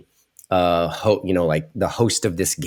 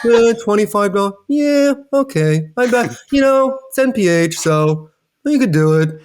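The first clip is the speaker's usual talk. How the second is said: bored lukewarm imitation; 12 semitones higher, 3 dB louder than usual. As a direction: imitate a host who couldn't care less. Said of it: "you were like".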